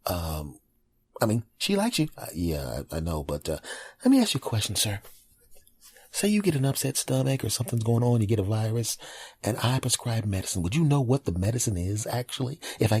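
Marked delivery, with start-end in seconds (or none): 4.0-7.8 doctor voice. Acted. Doctor sketch, posh doctor voice.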